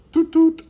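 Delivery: high, loud trumpet toots; piercing high tooting; trumpet imitation. A narrator's reading. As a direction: blast it out like a toy trumpet. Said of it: "Bean plays his trumpet".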